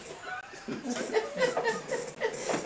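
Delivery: high breathy burst of laughter; sudden high cackling laugh. Real, not acted. Laughs at sheriff's reply.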